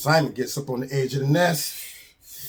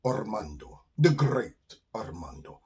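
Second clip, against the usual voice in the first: put-on low, slow, accented self-introduction; Armando the skunk; 6 semitones lower, 6 dB quieter than usual. Skunk introduces himself to the bird, grandly self-important. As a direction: announce the name grandly with rolled R.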